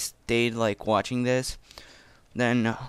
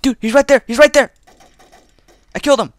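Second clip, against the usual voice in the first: high, loud frantic yelling; panicking gamer; 11 semitones higher, 9 dB louder, faster than usual. Real, not acted. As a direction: scream it in a panic. Firefight, enemy spotted.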